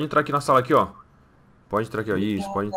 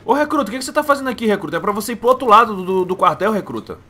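higher, fast, loud scolding; barking at recruit; 8 semitones higher, 4 dB louder than usual. Acted. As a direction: yell at the recruit to get moving. Soldier scolds the loitering recruit. (soldado dá bronca no recruta, gritando rápido)

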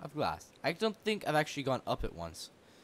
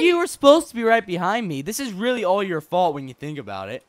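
loud, higher-pitched accusation easing slightly; raised-voice protest at a teammate; loud blaming; 4 semitones higher, 10 dB louder than usual. Real, not acted. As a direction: throw the blame back loudly. Blames partner in mock quarrel.

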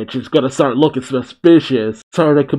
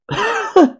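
squeaky high laugh. Real, not acted. Laughs at reveal.

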